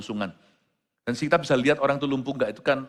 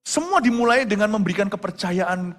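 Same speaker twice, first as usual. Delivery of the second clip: higher, pressing sermon warning; preacher warning the church against the occult, rising urgency; urgent warning; 9 semitones higher, 5 dB louder than usual. Acted. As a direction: plead with them not to play with it. Warning the congregation.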